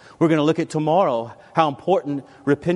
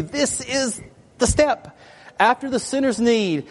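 higher, slow, emphatic reading; quoting the author; 8 semitones higher than usual. Retold or imitated. Pastor quoting the book's key statement, slow and emphatic. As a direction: read it out like a revelation. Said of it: "she tells you".

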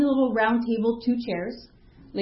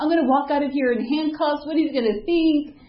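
higher, mildly panicked rush of worry; retold catastrophizing; 6 semitones higher than usual. Accused daughter dreading her father's reaction, retold catastrophizing. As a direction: spin out the worst case, pitched up. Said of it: "you know, catastrophizing".

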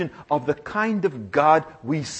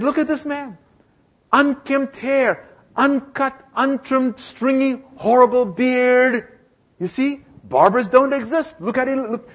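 higher, insistent, making his point; to the barber, proving his point, insistent and raised; pointed customer, pitched higher; 6 semitones higher than usual. Acted. Customer shows the street person.

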